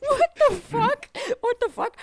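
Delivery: very high squeaky disbelief; exaggerated high-pitched alarm; mock bewilderment. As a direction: squeal it, on the edge of laughter. Acting out bewildered reaction, laughing.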